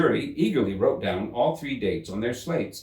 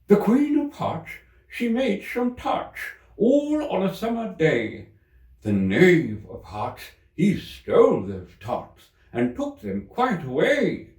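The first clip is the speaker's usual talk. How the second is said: much higher, slow ceremonial reading; rabbit reading aloud; 10 semitones higher, 4 dB louder, slower than usual. A narrator's reading. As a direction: read it out like a herald's proclamation. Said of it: "read as follows".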